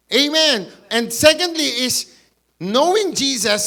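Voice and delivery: ordinary talk, nothing marked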